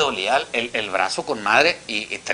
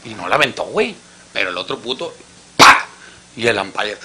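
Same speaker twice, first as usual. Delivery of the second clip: ordinary talk, nothing marked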